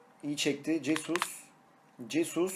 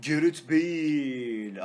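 drawn-out goal call; 4 dB louder, much slower than usual. Real, not acted. Gol sonrası isim uzatılarak.

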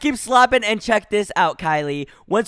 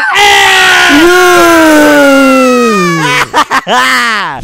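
big laugh; 3 semitones higher, 11 dB louder than usual. Real, not acted. Huge laugh after nuking.

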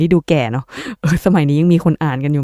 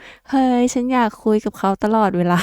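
higher, sugary put-on voice of someone newly in love; giddy lovestruck voice; 5 semitones higher than usual. Acting out a new crush gushing to viewers, giddy. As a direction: gush like you can't stop texting them. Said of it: "ทำไมต้องทำเสียงอย่างนี้".

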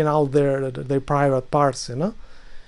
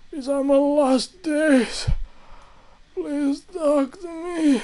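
high, slow, crying plea; mock dying woman; 7 semitones higher than usual. Voicing vaccinated woman, dramatic.

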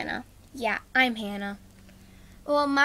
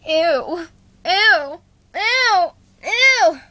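louder and higher each ew; disgusted, building; 6 semitones higher, 8 dB louder, much slower than usual. Acted. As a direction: climb into a squealing ew. Escalating disgust chorus.

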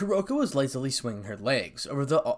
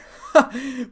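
loud, high burst of scornful laughter; mocking angry laugh; 12 semitones higher, 11 dB louder than usual. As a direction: laugh sharply in angry disbelief. Bitter laugh amid angry quarrel.

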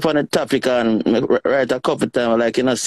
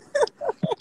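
high giggle; 7 dB quieter than usual. Real, not acted. Friends joking, high laugh.

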